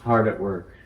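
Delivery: deliberate poetry reading, phrase openings lightly pressed; poem recitation. Acted. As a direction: recite it line by line, leaving space after each phrase. Reads own poem aloud to group.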